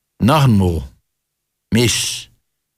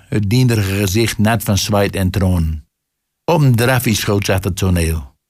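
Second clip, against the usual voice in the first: ordinary delivery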